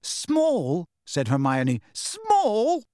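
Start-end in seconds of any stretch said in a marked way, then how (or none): 0.0-0.8 high, disbelieving echo; incredulous. A narrator's reading. Hermione echoes in disbelief. 1.9-2.8 very high, rising squeak; outraged disbelief. A narrator's reading. Hermione echoes, more incredulous.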